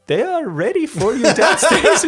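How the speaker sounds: high, loud attempt at a Swiss butler accent. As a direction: put on a comic Swiss accent. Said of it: "A butler with a Swiss accent enters the room".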